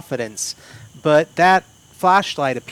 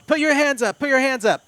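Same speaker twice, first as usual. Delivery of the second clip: high, loud repeated command; yelled order; 11 semitones higher, 6 dB louder than usual. Acted. Guard aiming weapon at intruder, yelling orders. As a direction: yell it as a guard taking aim. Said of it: "begins yelling out in Spanish".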